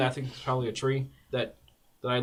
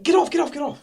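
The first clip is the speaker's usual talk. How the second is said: high shout, fading at the end; frantic shouting; 11 semitones higher than usual. Acted. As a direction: desperately yell it off. Shoving the owlbear away.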